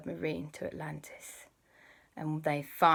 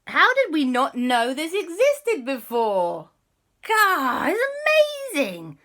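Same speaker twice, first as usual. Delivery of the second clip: loud, rising astonished gasp; amazed; 9 semitones higher, 9 dB louder than usual. Acted. Explorers discovering Atlantis, loud amazed and rising. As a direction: build up from shock to wonder, high. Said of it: "Kwasi and Dashi were like".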